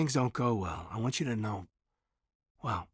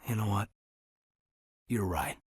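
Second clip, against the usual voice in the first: normal talking voice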